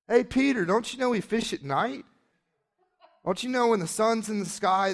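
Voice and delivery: high-pitched heckle across the water; acted jeering call from the shore. Other crews call out to Peter.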